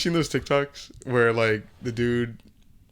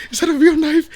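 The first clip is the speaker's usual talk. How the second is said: high, loud mock-scared movie quote; mock alarm; 9 dB louder than usual. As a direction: act alarmed, pitched way up. Acting out a movie scene.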